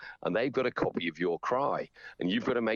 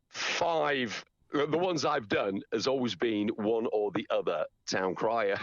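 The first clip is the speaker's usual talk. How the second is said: usual speaking voice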